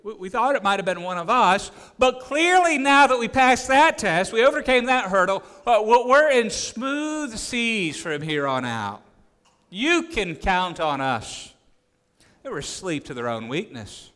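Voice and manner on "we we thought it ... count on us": high, loud, overconfident boast; hammed-up disciples. Disciples boasting to Jesus, hammed-up and high. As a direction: gush with smug confidence. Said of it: "All the other disciples".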